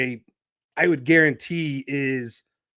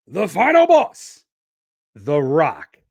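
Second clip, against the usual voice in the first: hyped nickname announcement; 6 dB louder than usual. Acted. Host revealing Cena's rival to listeners, hyped announcement. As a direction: boom out the nickname with a big lift.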